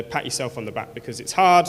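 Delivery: ordinary talk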